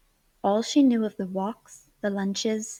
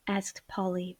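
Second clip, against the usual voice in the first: usual speaking voice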